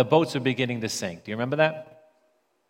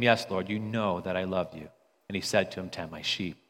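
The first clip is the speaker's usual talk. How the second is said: softer, lower and gentle; tender; 6 semitones lower, 6 dB quieter than usual. Acted. Jesus and Peter on the shore, tender and soft. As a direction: speak it like a quiet confession.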